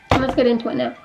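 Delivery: ordinary talk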